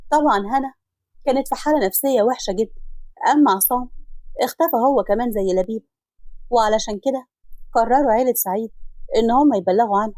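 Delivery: ordinary delivery, nothing marked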